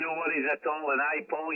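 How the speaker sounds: ordinary talk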